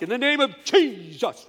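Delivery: loud, high hammed-up command; mock shout. Scene: preacher mocking his own loud deliverance, shout. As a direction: bellow it like a dramatic exorcist. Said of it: "Me, I'm like".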